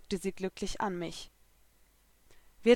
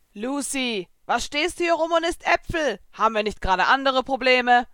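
higher, exasperated reproach; indignant, pushed-up questioning; exasperated scolding; 5 semitones higher, 5 dB louder than usual. A narrator's reading. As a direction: scold a friend for dawdling. Friend scolds her for idling.

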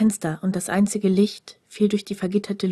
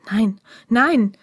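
louder, desperate no; panicked cry; 6 dB louder than usual. A narrator's reading. Prisoner dragged from his cell, panicked louder cry. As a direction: cry out as hands drag you away.

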